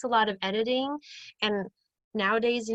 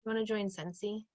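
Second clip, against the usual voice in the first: flat uninterested ask; 10 dB quieter than usual. Shown as a demonstration.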